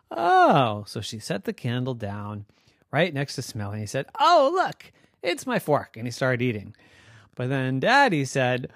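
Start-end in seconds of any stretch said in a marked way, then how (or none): 4.2-6.0 put-on high, happy kid exclaiming; thrilled little boy spots it; delighted child. Smelly finds fork.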